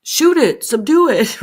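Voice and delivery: mock command. Imitating onlookers demanding the animal be shot. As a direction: bark it like a fed-up bystander. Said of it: "they're gonna say".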